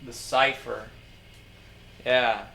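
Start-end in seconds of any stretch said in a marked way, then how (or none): none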